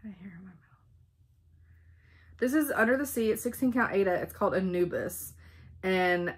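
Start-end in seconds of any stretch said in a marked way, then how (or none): 0.0-0.9 whispered aside. Real, not acted. Aside while filming.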